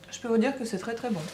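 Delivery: talking with a mouth full; words round a bite of food. Real, not acted. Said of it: "avec la bouche pleine".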